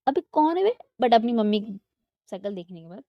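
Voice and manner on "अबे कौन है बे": high, loud rude yell; angry shout. Acted. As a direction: yell at whoever grabbed your ear. Avika snaps at someone pulling her ear. (ऊँची, ज़ोरदार गुस्से वाली चीख़)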